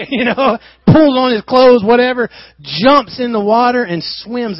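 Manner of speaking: high, loud rush of telling; laughing excitement; excited. Real, not acted. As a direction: burst out with excitement. Peter's eager leap.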